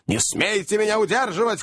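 high, outraged yell; shouting old man. A narrator's reading. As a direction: protest furiously at being held back. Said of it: "кричал старик".

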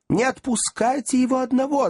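very high panicked squeal; women's shriek. A narrator's reading. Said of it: "завизжали женщины".

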